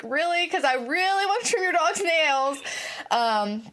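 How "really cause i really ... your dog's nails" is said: higher, eager disbelief; mock pleading. To customers who said no, mock eager pleading. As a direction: beg a little to trim the dog. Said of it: "I'm like".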